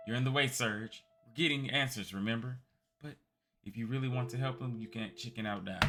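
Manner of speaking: put-on loud, pushy young thief; tough girl Kid. Kid interrogating the old man, pushy and loud. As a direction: shove the question in his face. Kid's voice.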